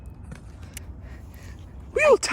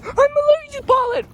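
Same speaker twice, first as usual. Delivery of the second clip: high whiny voice; 4 dB louder than usual. Acted. Character reacting to pollen.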